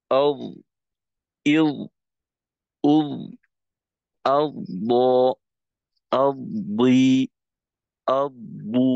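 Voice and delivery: আউ, ইউ, উ with the voice never cut off; ḍad with voice kept open. Shown as a demonstration. Teacher showing how to keep the ḍad voiced. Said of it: "যেমন".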